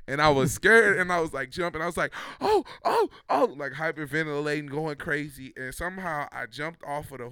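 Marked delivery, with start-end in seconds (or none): 2.4-3.5 very high, loud gasping "oh"; shrieking mock panic; panicked cries. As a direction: imitate hyperventilating terror. Hyperventilating on the horse.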